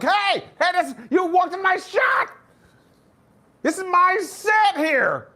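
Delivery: high, angry shout at someone in his shot; mad gym guy. Retold or imitated. Gym bro yelling at a passerby, angry high shout. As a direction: yell like your camera shot was ruined. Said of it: "And they get mad".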